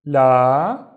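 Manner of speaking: la with a loud, long aa; long vowel. Shown as a demonstration. Teacher reading the first syllable, la held long. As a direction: stretch the vowel in la. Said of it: "long because of the alif".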